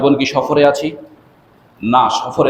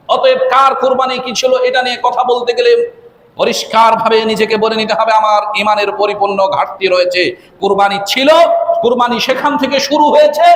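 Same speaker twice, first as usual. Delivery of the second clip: high, insistent sermon delivery; forceful preaching; 10 semitones higher than usual. Real, not acted. Preacher cautioning listeners about unknown history of sacrifice, forceful preaching. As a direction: press each point in a raised, ringing voice.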